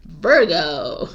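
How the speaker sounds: said through a laugh. Real, not acted. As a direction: giggle through the word.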